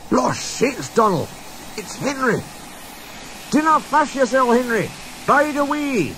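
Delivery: high, excited Scottish shout; Scottish accent, rescuing a friend; Scottish calling out. Acted. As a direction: call out cheerfully in broad Scots. Twins calling to stuck Henry.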